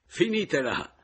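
high, loud call to stop; shout. A narrator's reading. Husband stops the quarrel after laughing, high shout. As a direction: cry out to cut them off.

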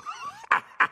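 high acted cackle; belly-laugh in character; jeering, high-pitched guffaw. A narrator's reading. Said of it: "Sghignazzò Simmio, sbudellandosi".

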